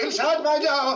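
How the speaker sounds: high, loud, tearful reading; demo line for child. Shown as a demonstration. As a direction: show the child how to cry it.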